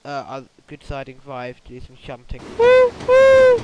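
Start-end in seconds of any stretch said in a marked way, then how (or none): 2.6-3.6 train whistle. Pretend.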